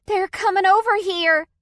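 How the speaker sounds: high, loud panicked scream. A narrator's reading. Chloe, trapped in the car, screams in panic. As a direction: shriek it in alarm. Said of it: "Chloe screamed".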